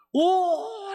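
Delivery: a sudden high, loud wail of "오"; anguished cry. Retold or imitated. Pastor voicing Paul's despairing outcry as a high wail. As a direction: howl "오" in anguish. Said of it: "이렇게 절규하는 거예요".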